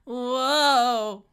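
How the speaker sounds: loud, high-pitched cry of alarm. Real, not acted. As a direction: cry out at a sudden scare in the game, voice jumping up. Alarm during the game.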